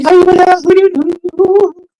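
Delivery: very high, drawn-out mock babble. Boss and shop girls talking Dutch, high-pitched mockery. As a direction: squeal out mock foreign chatter, slowing down. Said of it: "talking down to the girls".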